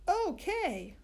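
put-on high, chorus of kids; class shouting back. A narrator's reading. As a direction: answer the teacher all together. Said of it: "we shouted back".

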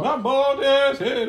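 high, loud, drawn-out mock wailing; hammed-up outrage, stretching each word; mock lament. Host mimicking a wife upset about her bald head, mock lament. Mock-voicing an aggrieved spouse.

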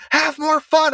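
high mock coach holler; imitated yelling. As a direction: call it out like a dad on the sideline. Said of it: "all he would yell is like".

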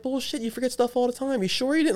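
high-pitched mock incredulity; retold accusing disbelief. Husband re-enacting argument with his wife, accusing and disbelieving. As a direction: challenge her story in a high, doubting voice.

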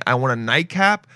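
ordinary talk, nothing marked

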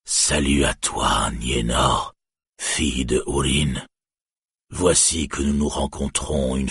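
a dying dragon gloating slowly; panting dragon. A narrator's reading. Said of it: "et haletant il parla". (voix de dragon grave et lente, haletante)

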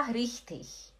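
richtig ending like ich; preferred ch ending. Shown as a demonstration. Teacher telling Lacerda own preference, soft ch ending. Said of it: "Eu gosto de falar".

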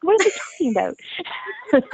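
high, slow starstruck disbelief; shocked squeal. Retold or imitated. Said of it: "me in that same voice was like".

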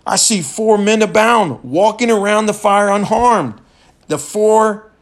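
high, loud royal shout of wonder; a king shouting in amazement at the fire. Retold or imitated. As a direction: cry it out to the whole court, astonished. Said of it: "Nebuchadnezzar shouted".

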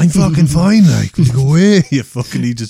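loud, slurred I'm fine; drunken protest. Retold or imitated. Drunk insisting he's fine.

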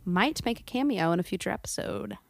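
ordinary talk

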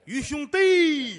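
slow, high, loud call of 于兄弟; calling brother Yu playfully; drawn-out call. Acted. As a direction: drag out 于兄弟 with a laugh. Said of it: "行了，别瞎说八道了".

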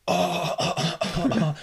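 loud, high mock gasps of release; big hammed-up "oh" of relief; imitated gasp of relief. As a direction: act out a patient suddenly getting air back. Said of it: "the guy just goes".

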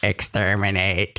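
slow robotic Dalek screech. Retold or imitated. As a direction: grate it out like a Dalek. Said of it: "microphones look like Daleks".

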